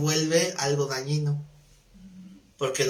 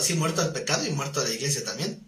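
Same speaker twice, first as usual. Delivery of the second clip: ordinary talk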